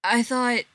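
"I thought" left hanging, unfinished; tailing off. A narrator's reading. Rejected Spencer to his ex, "I thought" tailing off unfinished. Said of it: "Spencer tails off".

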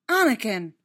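loud, shocked exclamation of a name; startled rebuke. A narrator's reading. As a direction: cry out his name in shock. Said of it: "Obi-Wan's voice was shocked".

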